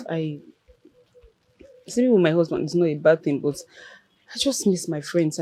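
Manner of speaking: ordinary talk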